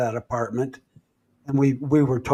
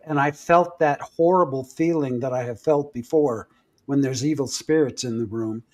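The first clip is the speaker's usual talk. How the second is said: usual speaking voice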